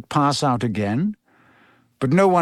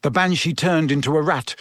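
ordinary delivery, nothing marked

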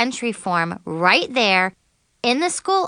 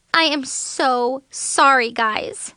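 slow, drawn-out apology, higher at the start; muttered apology; 5 semitones higher, slower than usual. A narrator's reading. Girl apologizing to her bandmates, a slow remorseful mutter. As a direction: apologize slowly and regretfully. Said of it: "I muttered".

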